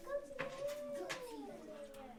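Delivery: [name]. Retold or imitated. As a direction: stretch it out high. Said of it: "That's what [name] was like".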